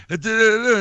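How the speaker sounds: high, loud nonsense syllables; imitated unintelligible chatter. Imitating laughing kid at work, gibberish chatter. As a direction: babble like a kid telling a joke nobody catches. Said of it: "going and saying something to me".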